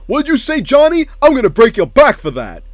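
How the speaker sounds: high, loud outraged threat; furious yell. Retold or imitated. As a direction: scream it like an enraged fighter. Acted Mortal Kombat cutscene.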